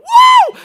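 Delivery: huge high whoop; shouted woo of joy; joyful shout. Retold or imitated. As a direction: let out a church whoop. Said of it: "I'm gonna shout just a minute".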